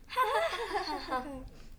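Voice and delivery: sly chuckle. Acted. Mischievous king laughs.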